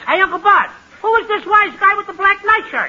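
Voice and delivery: put-on childish wise-guy question; cheeky little boy. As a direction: pipe up as a brat insulting the judge. Boy mocks the judge.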